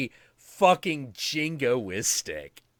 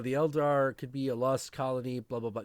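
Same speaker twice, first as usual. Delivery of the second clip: ordinary talk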